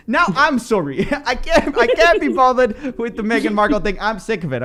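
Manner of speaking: high, bad British accent; fake British accent. Complaining about the Meghan Markle topic in a fake British accent. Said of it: "enough with the British accent, fake bad British accent".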